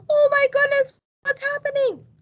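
gasp, then a high loud exclamation; a hammed-up surprised gasp. Shown as a demonstration. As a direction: act amazed for the children. Reacting to surprising picture.

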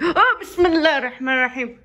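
laughing. Real, not acted. Overwhelmed by cute cat.

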